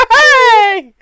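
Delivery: high, loud, gushing anime cheer; Tokyo Mew Mew style. Pretend. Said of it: "that's Tokyo Mew Mew style".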